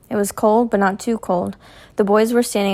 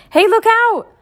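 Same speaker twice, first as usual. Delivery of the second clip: warning shout; 13 semitones higher, 5 dB louder than usual. A narrator's reading. Warning of truck.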